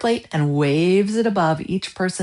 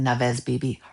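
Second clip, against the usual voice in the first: plain reading voice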